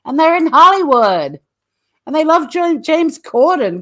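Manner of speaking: high-pitched mocking voice of gushing fans; exaggerated high protest, mimicking naive admirers; mock Americans. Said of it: "but Americans are like".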